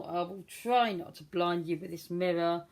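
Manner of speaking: ordinary talk, nothing marked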